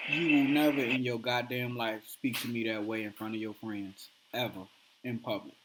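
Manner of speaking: low, soft, icy calm warning; calmest voice. Retold or imitated. Husband calmly warning his wife after she shamed him, cold quiet calm. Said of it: "In his calmest voice".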